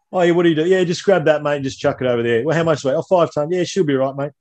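high, hammed-up Aussie tradie banter; aussie tradesman. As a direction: play two Aussie blokes shrugging it off. Acted dialogue of two tradesmen.

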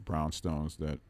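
usual speaking voice

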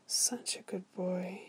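slow, soft whisper; lingering, hushed approval; whispered praise. A narrator's reading. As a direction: whisper it slowly, drawing out the praise. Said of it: "he continued".

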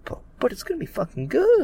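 high-pitched, gushing anticipation; excited hype. Real, not acted. Podcaster talking up the coming match, excited. Fan hyping the upcoming show.

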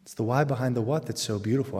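usual speaking voice

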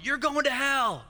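hammed-up hellfire shouting; street preacher yelling. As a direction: bellow it at passersby like a corner preacher.

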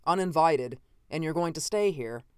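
ordinary delivery

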